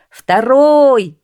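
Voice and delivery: high, loud drawn-out cheer; loud, high tally cry; crowd shout. A narrator's reading. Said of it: "кричит толпа".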